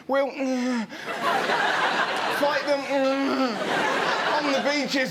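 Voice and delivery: halting, slow stammering speech; nervous Churchill speech. Retold or imitated. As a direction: deliver Churchill while terrified. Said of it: "Imagine him making speeches".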